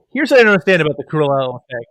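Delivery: ordinary delivery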